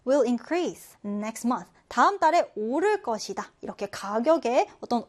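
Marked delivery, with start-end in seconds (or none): 0.1-1.7 very slow, clear read for learners; slow model sentence. Shown as a demonstration. Teacher reading the example sentence slowly for students. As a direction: read each word out slowly. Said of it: "예문 보면".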